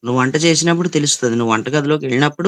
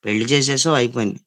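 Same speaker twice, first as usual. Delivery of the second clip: ordinary talk, nothing marked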